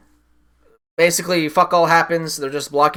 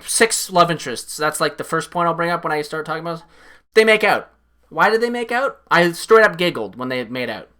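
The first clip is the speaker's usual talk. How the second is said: ordinary talk, nothing marked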